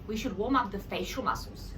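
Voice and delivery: ordinary delivery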